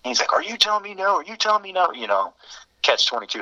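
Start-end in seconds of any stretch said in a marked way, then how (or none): 0.3-1.9 much higher, incredulous demanding repeat; a hint of a tough guy daring him; mock challenge. Senior daring the pledge who refused him, incredulous.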